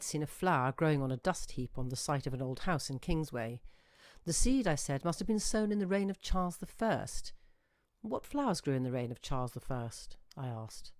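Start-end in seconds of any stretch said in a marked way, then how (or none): none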